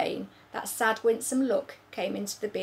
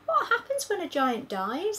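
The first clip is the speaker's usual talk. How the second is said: put-on much higher small-girl voice; a puzzled little girl; girl's voice; 10 semitones higher than usual. Sophie puzzles over giants, higher girl's voice.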